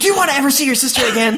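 high, rapid mock threat; hammed-up yelling. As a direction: fire off an unhinged villain's threat. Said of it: "every time he yells".

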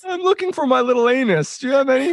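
high mock voice imitating mom. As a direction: tease with a squeaky high impression. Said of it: "I didn't say it like that".

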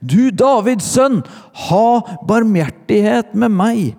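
very high, loud, insistent plea. A narrator's reading. Blind beggar refusing to be silenced, shouting high and loud. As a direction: cry out over those hushing you. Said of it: "ropte bare enda høyere".

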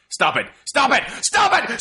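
from plain up to a high shout; building yell. Shown as a demonstration. Quoting the bleak movie ending, rising to a shout. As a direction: ramp it up on each repeat. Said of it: "this is something I quote all the time".

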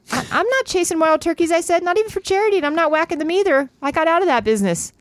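protesting heroine. Acted. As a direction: protest up high, a touch indignant.

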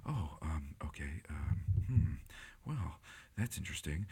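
soft, dazed mumble; mumbling. Retold or imitated. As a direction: mutter it to yourself. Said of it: "mumbling to himself".

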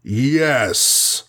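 restrained roar of a giant; a sudden roar, held at reading volume. A narrator's reading. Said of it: "roared Goliath suddenly".

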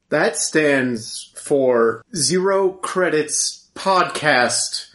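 slow announcer voice. Acted. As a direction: announce the podcast name slowly. Slow show name announcement.

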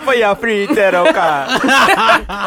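very slow, high, loud laughing hotline pitch; mock Jamaican accent. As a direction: drawl it out like a TV psychic selling readings. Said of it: "She was Miss Cleo and shit".

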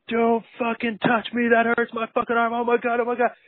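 high-pitched freaked-out yell, mimicking a patient; mock-hysterical high scream; imitated screaming. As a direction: act out a panicked scream, pitch way up. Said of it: "he starts screaming".

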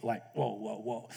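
awed whoa trailing off; fading whoa. Real, not acted. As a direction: let the whoa fade to nothing. Overwhelmed by 600 rules.

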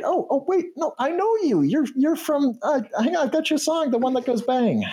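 very high, squeaky starstruck voice; excited high canary chatter; excited fan. Acted. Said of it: "Seems kind of excited, really happy".

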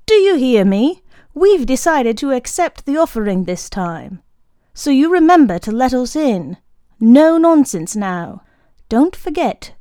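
loud, high and commanding. Acted. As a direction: order the dog about from the fence. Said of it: "raising his voice".

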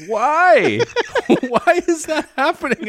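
high, loud disbelieving why; incredulous mock cry. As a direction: squeal it in disbelief. Mock exasperated reaction.

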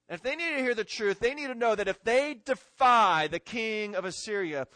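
rising pulpit intensity; fired-up preaching. Acted. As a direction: preach it hard to the room.

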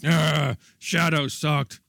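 slow, mock-whiny gripe; lightly hammed-up dismissal; dismissive whine.

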